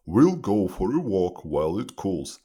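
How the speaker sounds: lightly voiced father bear; slightly gruffer storybook papa bear; a hint of a big bear father. A narrator's reading. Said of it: "says Father Bear".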